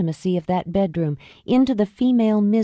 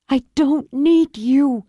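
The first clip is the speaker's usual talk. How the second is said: slow, forceful refusal; fierce, louder denial; 8 semitones higher, 4 dB louder, slower than usual. Acted. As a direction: push him away hard. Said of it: "she said it fiercely".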